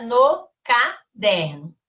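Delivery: "no caderno" slowed right down, broken into beats; "no caderno" said slowly and deliberately, with gaps for emphasis; emphatic separated syllables. Real, not acted. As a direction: spell out "no caderno" as no, ca, derno. Teacher stresses where work goes.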